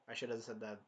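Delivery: usual speaking voice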